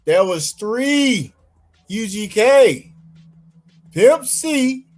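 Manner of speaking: slow, drawn out announcing; slow emphatic. Real, not acted. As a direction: stretch each name out slowly. Slow emphatic listing of names.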